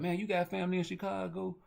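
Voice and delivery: squeaky mock question, light and curious; mock high voice. Stranger quizzes him about family in Chicago, squeaky mock voice. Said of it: "be like".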